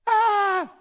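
high startled yelp; mock scream of fright. Guards seeing the angel, a high mock scream.

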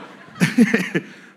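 loud, high laugh; real embarrassed laugh. Real, not acted. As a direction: laugh off an awkward moment out loud. Laughs off the awkward pause.

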